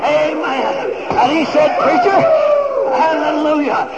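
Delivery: loud, high-pitched revival shouting; full-force preaching. Real, not acted.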